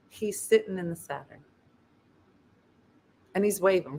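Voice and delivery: ordinary delivery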